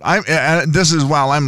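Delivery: usual speaking voice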